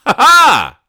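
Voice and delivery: a loud, high, put-on "ha ha" cackle; high mocking laugh. Host mocks the babysat kids with a loud high cackle.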